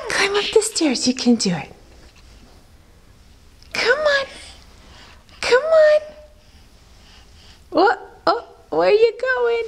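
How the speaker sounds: high sing-song encouragement. Real, not acted. Coaxing baby up stairs.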